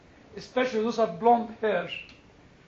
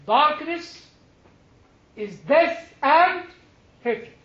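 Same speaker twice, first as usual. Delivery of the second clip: preaching emphasis; 6 semitones higher, 7 dB louder, much slower than usual. Acted. Sermon climax on two powers.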